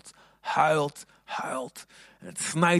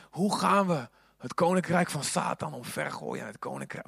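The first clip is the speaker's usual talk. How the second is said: ordinary talk, nothing marked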